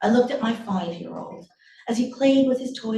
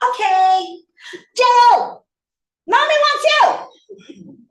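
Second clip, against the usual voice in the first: high, loud yell for a brother; shrill-pitched child's shout, last words drawn out; child calling out; 9 dB louder, slower than usual. Acted. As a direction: holler through the house like a little kid. Child calls brother from another room.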